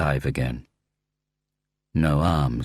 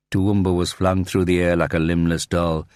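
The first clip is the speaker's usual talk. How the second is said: plain reading voice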